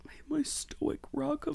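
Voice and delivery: breathy whispered swoon; dreamy whisper. Pretend. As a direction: whisper it like a lovestruck girl. Dreamy praise of her husband.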